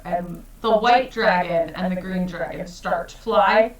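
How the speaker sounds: usual speaking voice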